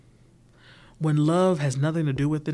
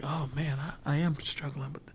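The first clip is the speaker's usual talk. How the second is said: quiet admission; 7 dB quieter than usual. Retold or imitated. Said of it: "you're like".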